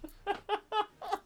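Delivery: high giggling laugh; burst of laughter. Real, not acted. Podcaster cracking up after reading the review, laughing. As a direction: break into laughter.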